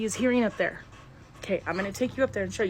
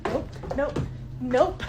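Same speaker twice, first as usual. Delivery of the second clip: alarmed refusal; 4 semitones higher, much slower than usual. Real, not acted. Woman retreating from a door that moved, alarmed. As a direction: blurt each nope in real alarm. Backing away from a scare.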